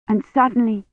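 usual speaking voice

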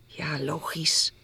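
soft, slow, nearly whispered; hushed, hesitant reply; subdued agreement. A narrator's reading. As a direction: agree quietly, a little unsure. Girl left waiting, slightly worried.

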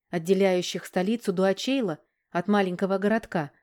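usual speaking voice

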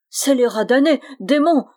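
loud, high-pitched cursing; shouting. A narrator's reading. Wretched friar cursing the demon riding him, shouting. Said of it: "criait le malheureux Bruno".

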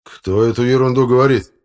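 loud, high demand; outraged. Acted. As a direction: demand to know who dared. Outraged chairman demands the source. (возмущённо, громко)

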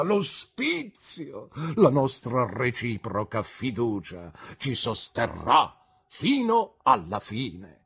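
loud, high, stretched-out key words; melodramatic, defiant proclamation; grand declamation. A narrator's reading. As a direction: declaim it like a tragic orator. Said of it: "Declamando queste frasi".

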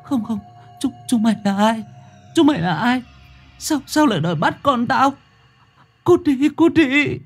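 high-pitched, stammering frightened wail; tearful whimper. A narrator's reading. Frightened woman driving off two ghostly children, tearful whimper. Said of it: "Yến nghe thế vậy thì mếu máo".

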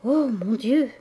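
drawn-out, understated sighing cry; a touch of a sigh; sighed exclamation. A narrator's reading. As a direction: let it out like a mild sigh. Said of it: "il soupira".

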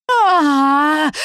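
long, high scream; high-pitched acted wail of panic; panicked wail. A narrator's reading. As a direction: scream out in sustained fright. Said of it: "завопила я".

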